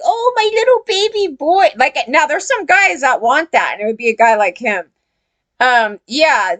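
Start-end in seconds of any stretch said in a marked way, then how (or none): 0.0-1.7 put-on much higher, cooing baby-talk voice; mock baby talk. Mocking what a guy would want if she babied him, high cooing baby talk. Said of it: "what would the guy do if I'm like".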